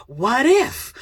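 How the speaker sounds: rising, open-ended "what if"; wondering, voice climbing expectantly; hopeful anticipation. Shown as a demonstration. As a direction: lift it up toward a possible different outcome. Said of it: "your voice even can, can anticipate".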